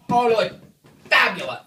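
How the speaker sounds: mock fabulous exclamation. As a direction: belt out a campy compliment. Mock glamour fashion compliment in recap.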